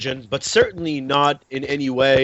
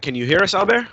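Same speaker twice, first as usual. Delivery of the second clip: calling out; 8 semitones higher, faster than usual. Real, not acted. Calling to a reconnecting caller.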